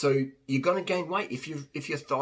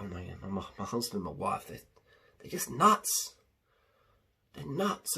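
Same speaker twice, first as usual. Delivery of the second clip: lower, softer mock moan about the spouse; weary complainer; 5 semitones lower, 3 dB quieter than usual. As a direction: grumble it like a fed-up partner. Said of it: "They're like".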